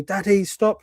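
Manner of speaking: a light take on a small daughter pleading; imitating his daughter. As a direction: mimic a little girl begging her dad. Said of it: "my daughter's going to me".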